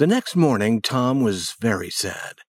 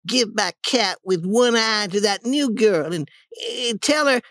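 put-on high, plaintive boy's voice; dying act; 11 semitones higher than usual. Tom faking last words to Sid, plaintive and high. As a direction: give last wishes in a pitiful high voice. Tom's mock last wishes.

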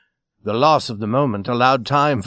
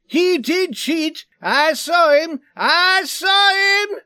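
high, drawn-out, loud insistence; screaming accusation; 4 dB louder, slower than usual. A narrator's reading. Swede screaming over the brawl, high accusing scream. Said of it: "The screams of the Swede never ceased".

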